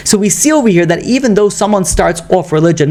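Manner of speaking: ordinary talk, nothing marked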